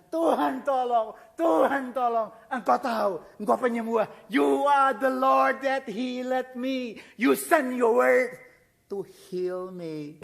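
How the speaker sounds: loud, slow cry to God that fades at the end; king pleading in prayer; desperate prayer. Acted. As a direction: beg God for healing. Hezekiah's crying prayer to God.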